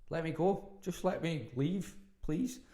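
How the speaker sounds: high, drawn-out pleading; exasperated plea. Retold or imitated. Storyteller re-enacts asking the date to let them go, exasperated plea. Retold plea to get away.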